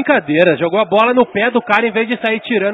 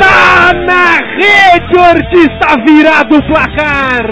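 slow, higher, louder shout; explosive goal commentary; 7 semitones higher, 6 dB louder, slower than usual. Real, not acted. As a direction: yell the goal celebration. Cocommentator celebrates goal.

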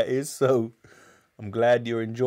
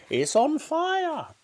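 piercing high, stretched alarm cry; yelling; slower than usual. A narrator's reading. Grandma spotting smoke from the hen, yelling. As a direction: screech the alarm slowly in a granny voice. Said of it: "Grandma yelled".

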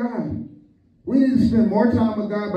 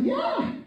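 very high martial-arts cries; Bruce Lee; 6 dB quieter than usual. Retold or imitated. Preacher imitates a movie fighter for laughs, high kung fu cries. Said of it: "every time he fight".

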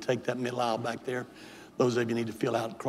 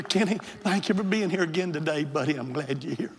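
normal talking voice

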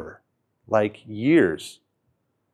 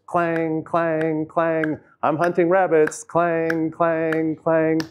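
higher, playful clanging hunter voice; mock rabbit hunter with cymbals; 6 semitones higher, much slower than usual. Retelling Alan Watts' parable to listeners, in a higher mock hunter voice. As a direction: ham up a clueless hunter banging cymbals. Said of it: "Alan Watts is famous for saying".

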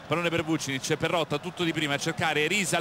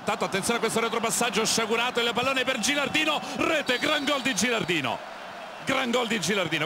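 higher, urgent goal call; excited goal call; 5 semitones higher than usual. Real, not acted. Gilardino scores, live call.

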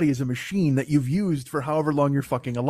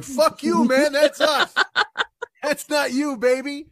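high-pitched mock outrage; hammed-up aggrieved complaint; indignant protest; 9 semitones higher, slower than usual. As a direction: angrily claim the credit as the overlooked workers. Jesus's knees claim the credit.

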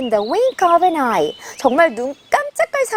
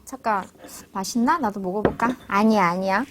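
much softer, lower, to oneself; a hare's private temptation; muttered aside; 8 semitones lower, 8 dB quieter, faster than usual. Acted. 토끼가 혼잣말로 작게 중얼거림. Hare musing to itself aside.

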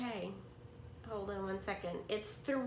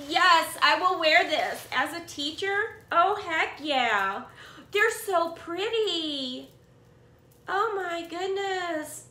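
much louder, enthusiastic cheer; loud delight; 4 semitones higher, 7 dB louder than usual. Real, not acted. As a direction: cheer like you just won.

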